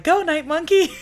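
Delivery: high, loud cheer through laughter; excited high-pitched chant, playfully imitated; mock cheer. Crowd cheering on hero.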